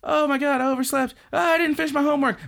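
high, loud, rushed squeal; high panicking schoolgirl who overslept; panicked anime girl. Retold or imitated. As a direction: blurt it out, fast and frantic. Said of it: "and then she says".

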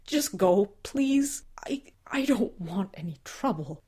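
unvoiced, pleading whisper; frightened whisper. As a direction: beg them to leave, whispering.

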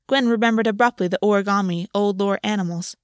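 ordinary delivery

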